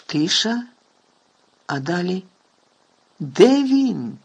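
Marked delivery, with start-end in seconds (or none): 3.2-4.2 high, loud piercing cry; piercing shout. A narrator's reading. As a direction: yell it out sharply in alarm. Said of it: "пронизливо вигукнув член комітету".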